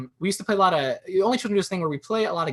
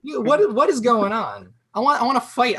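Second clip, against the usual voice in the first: higher, louder, exasperated outburst; mock outrage; 9 semitones higher, 6 dB louder than usual. Comic fed up with a TV host who likes every dish, in mock outrage. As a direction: rant like you've had enough.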